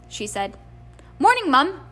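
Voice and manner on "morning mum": high, loud, chirpy greeting; jaunty, winning. A narrator's reading. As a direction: try to charm Mum with forced jauntiness. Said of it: "a jaunty, winning voice".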